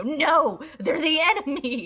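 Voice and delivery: loud, high cry of protest; Belcour's indignant yell. Acted. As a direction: shout it, outraged at your own crowd. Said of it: "you hear Belcour's go".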